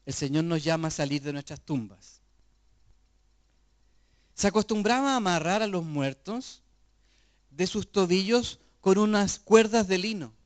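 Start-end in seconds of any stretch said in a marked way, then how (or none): 4.3-10.3 louder, driving preacher's voice; fervent preaching. Acted. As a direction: proclaim it to the congregation. Loud sermon explanation.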